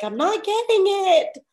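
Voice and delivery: high, slow whiny imitation of a confused student; mock frustrated Donna. Donna complaining she's not getting it, high slow mock whine. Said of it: "Donna was like".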